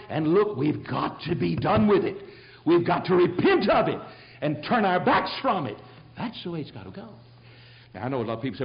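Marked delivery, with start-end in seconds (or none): 0.1-7.1 loud, high, driving sermon voice; fiery preaching. Acted. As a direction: preach it hard to the congregation. Preaching at full force.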